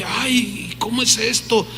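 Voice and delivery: soft retold bewilderment; mildly flustered. Preacher recalling his confusion as a new convert, softly flustered. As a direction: quietly imitate being at a loss. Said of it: "me, me turbaba".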